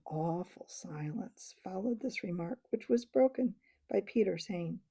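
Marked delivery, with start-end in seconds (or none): none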